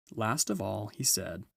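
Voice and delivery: plain reading voice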